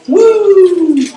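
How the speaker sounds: high loud wrestler woo; Ric Flair woo. Retold or imitated. [name] mimicking the local whooping again. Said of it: "Like Ric Flair".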